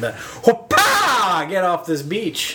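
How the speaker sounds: high yelp of an angry local; territorial local surfer. Retold or imitated. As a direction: shoo the tourist off the beach. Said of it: "That doesn't sound like a surfer".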